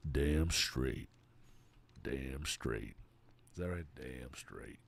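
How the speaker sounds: low and grumbling, kind of angry; Arthur Morgan voice. Retold or imitated. Streamer to chat, imitating Arthur Morgan low and annoyed. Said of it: "that's how he said it".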